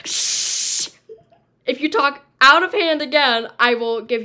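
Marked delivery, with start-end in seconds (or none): 0.0-0.9 whispered sharp shush; librarian shushing whisper. Pretend. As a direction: shush the noisy kids.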